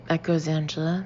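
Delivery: ordinary delivery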